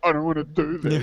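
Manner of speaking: put-on loud, whiny childish protest; tantrum whine. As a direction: whine it like a sulking kid. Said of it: "I had a real tantrum".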